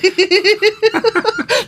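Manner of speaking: high-pitched run of laughter; high giggle. Real, not acted. Podcaster amused by co-host's stumble over David, giggling. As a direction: giggle helplessly.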